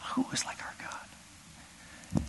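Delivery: slow breathy whisper; awed whisper. Real, not acted. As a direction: breathe it in wonder. Awed aside in sermon.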